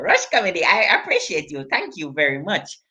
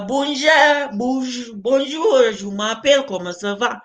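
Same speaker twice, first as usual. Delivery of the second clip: halting French greeting accent; put-on French. Shown as a demonstration. Comedian showing off languages, halting French accent. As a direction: show off a few French phrases. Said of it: "I speak a lot of language".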